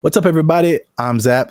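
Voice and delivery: ordinary delivery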